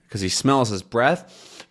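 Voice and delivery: usual speaking voice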